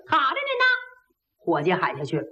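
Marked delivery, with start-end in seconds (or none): 0.1-0.6 waiter's reply. Acted. Waiter acknowledges order.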